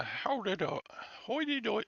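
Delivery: high-pitched, halting go at Irish; badly faked singsong Irish lilt; failed Irish accent. Streamer fumbling an attempt at talking Irish, failed accent. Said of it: "I can't talk Irish".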